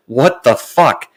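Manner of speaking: slow, drawn-out disbelief; exasperated. Retold or imitated. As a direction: drag it out, fed up. Exasperated at Spectrum agent.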